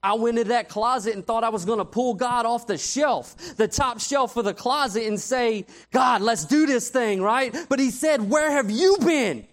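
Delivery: loud, rising preacher's climax; preaching at full force. Acted. Preacher to youth, pounding home the rebuke, loud. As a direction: build it up and hammer the point home.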